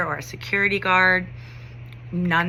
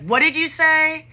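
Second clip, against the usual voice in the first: retold challenge, pitched up sharply; a little aggressive; 9 semitones higher, 4 dB louder than usual. Upset teacher turning on taunting students, retold aggressive challenge. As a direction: turn on them with a high confrontational demand. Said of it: "I was a little aggressive".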